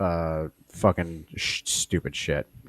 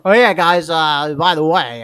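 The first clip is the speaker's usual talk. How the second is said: higher, louder hammed-up reporter, slowing down; mock field reporter; 8 semitones higher, 9 dB louder, slower than usual. Mocking a reporter caught off guard by the handoff. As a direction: play the flustered live reporter. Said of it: "I always love that awkward pause".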